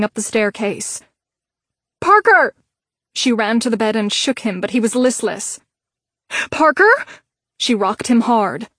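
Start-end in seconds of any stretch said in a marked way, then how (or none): 2.0-2.5 shouted high, falling; shouting his name. A narrator's reading. Fire, she screams his name. 6.5-6.9 loud, high, rising question; anxious calling. A narrator's reading. He doesn't respond, anxious call.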